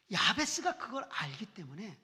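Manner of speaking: ordinary talk, nothing marked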